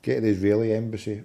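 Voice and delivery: ordinary delivery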